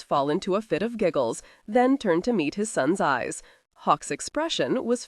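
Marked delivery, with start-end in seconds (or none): none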